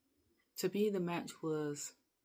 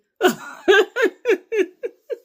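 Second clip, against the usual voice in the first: burst of laughter; 20 dB louder than usual. Real, not acted. Cracking up at the interview.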